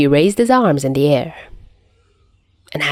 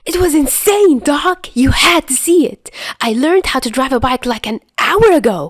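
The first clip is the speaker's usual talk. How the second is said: high, rushing, thrilled telling; breathless excitement; 7 semitones higher than usual. Acted. Chuya gushing to Doc about the car chase, high excited rush. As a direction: spill it all at once.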